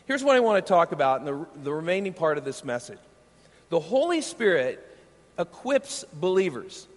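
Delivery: ordinary talk, nothing marked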